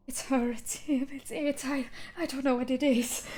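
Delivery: high, breathy confusion; much younger voice. Acted. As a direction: stammer it out, overwhelmed. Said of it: "it's a much younger voice".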